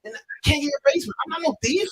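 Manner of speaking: high-pitched, fast indignant retort; heated protest. Retold or imitated. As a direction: snap back at the owner in disbelief. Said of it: "I said".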